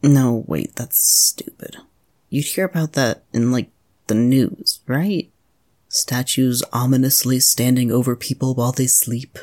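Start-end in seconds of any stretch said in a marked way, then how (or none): none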